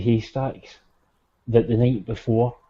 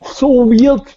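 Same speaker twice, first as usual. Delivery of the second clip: high amazed exclaim; 12 semitones higher, 9 dB louder than usual. Real, not acted.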